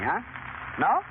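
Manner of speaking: ordinary delivery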